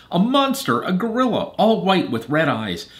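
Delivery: high, loud storybook alarm; acted screaming. A narrator's reading. Missus Gluckenstern reporting a monster, acted scream. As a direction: cry out each phrase in mock terror. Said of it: "Missus Gluckenstern continued to scream".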